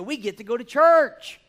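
high, loud, growing joy; rising excitement. Real, not acted. Preacher urging the congregation to love church, excited. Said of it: "building excitement about church".

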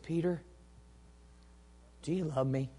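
soft, tender asking; gentle question. Acted. Gentle Jesus, contrasted with mean talk.

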